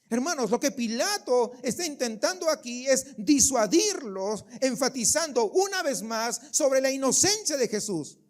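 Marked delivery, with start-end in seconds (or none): none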